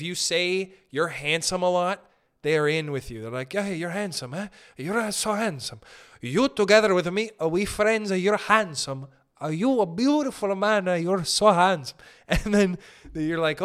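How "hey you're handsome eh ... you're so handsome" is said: put-on thick Italian accent. Italian relatives fussing over a handsome boy, Italian accent. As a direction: gush in imitation Italian-accented English. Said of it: "That's what Italians do".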